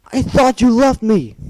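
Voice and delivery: a high, loud anguished wail. Acted. Filly crying out at her betrayer, loud wail. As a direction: wail it out, heartbroken. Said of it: "she wailed".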